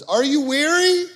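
high, loud, stretched-out call; soaring preacher's shout, words held long; preaching Jesus' invitation. Acted. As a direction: call out to the weary at full voice. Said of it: "Jesus says this".

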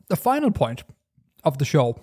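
usual speaking voice

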